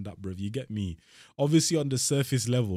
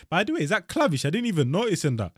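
surprised excitement; 8 semitones higher, 5 dB louder than usual. Real, not acted. Spots Clavish in the video.

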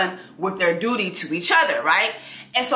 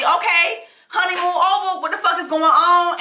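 higher, brassy demand; mock impatient queen; 7 semitones higher than usual. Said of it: "So she asked Brimsey".

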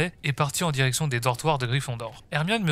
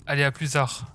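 ordinary delivery

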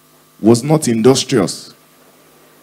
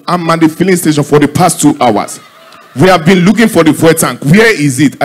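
higher, louder mock exasperation; exasperated complaint; 5 semitones higher, 5 dB louder than usual. Pastor at filling station hunting for fuel tank, exasperated. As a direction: complain loudly, acting fed up.

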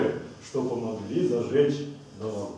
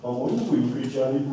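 ordinary talk

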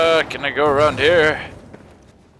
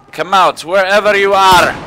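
calling out; 5 semitones higher, 5 dB louder than usual. Pretend. Taunting hidden enemy, raised voice.

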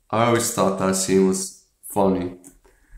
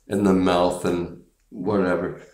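ordinary talk, nothing marked

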